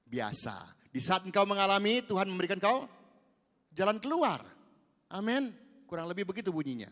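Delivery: ordinary delivery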